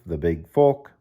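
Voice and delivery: ordinary delivery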